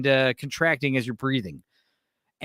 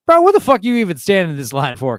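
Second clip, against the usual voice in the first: incredulous friend; 4 semitones higher, 7 dB louder than usual. Retold or imitated. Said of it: "looking at me like".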